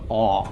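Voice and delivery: aw said as an open, rounded vowel like in saw; open aw sound. Shown as a demonstration. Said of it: "it's almost an A W sound".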